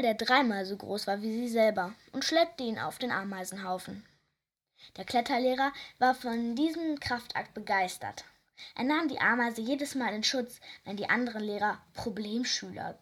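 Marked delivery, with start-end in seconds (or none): none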